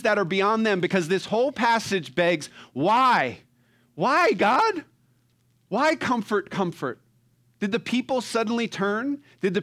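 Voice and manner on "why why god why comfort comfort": high, loud cry of why to God; anguished plea. Acted. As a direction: plead up to heaven, louder and higher. People's desperate question voiced.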